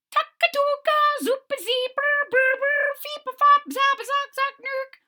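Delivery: very high chirping nonsense bird calls; piping high bird chatter; strange bird calls. Acted. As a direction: squeak it out like an odd mountain bird. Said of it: "I was making the bird sounds".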